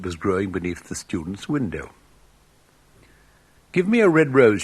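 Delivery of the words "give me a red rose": high, loud pleading cry; cried out. A narrator's reading. Nightingale crying out to the rose tree, high and loud. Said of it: "she cried".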